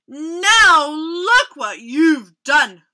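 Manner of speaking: loud, slow scolding bear; grumbling bear. A narrator's reading. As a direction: scold them as a grumpy storybook bear. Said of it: "grumbled Bear".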